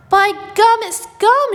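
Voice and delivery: very high, loud squeal of excitement; shrieking excitedly. A narrator's reading. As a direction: shriek it like a girl who just spotted gum. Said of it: "she shrieked".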